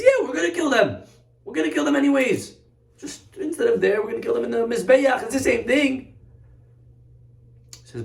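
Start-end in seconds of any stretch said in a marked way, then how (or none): 0.0-6.1 high-pitched, insistent protest; defensive excuse-making. Acted. King justifies the spared sheep to the prophet, high and defensive. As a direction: squirm out of it in a high voice.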